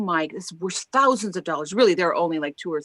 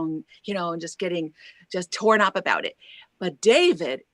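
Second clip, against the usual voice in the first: ordinary talk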